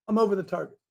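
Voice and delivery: ordinary talk, nothing marked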